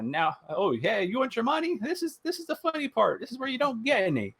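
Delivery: high-pitched Mickey-style mockery; high mocking meme voice. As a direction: taunt them like a cartoon mouse who keeps the money. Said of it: "It was like".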